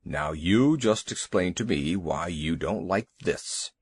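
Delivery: a big worried beast demanding answers; low slow elephant voice; lightly voiced elephant. Elephant Two Tails voiced.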